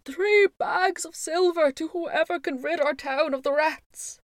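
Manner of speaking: high, loud proclamation from a desperate mayor; crying out. A narrator's reading. Lord Mayor offers silver to whoever helps, crying out. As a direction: call the reward out to the whole crowd. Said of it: "cried the Lord Mayor".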